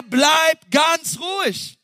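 high, slow shouted call; Moses calling out. Acted. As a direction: call out "Bleib ganz ruhig" across the crowd. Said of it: "Und dann sagt er".

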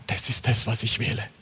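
hushed, low retelling of an outcry; subdued, halting vow; retold tearful cry. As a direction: recall the tearful choice in a near-whisper. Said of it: "in Tränen aufgelöst schrie ich auf".

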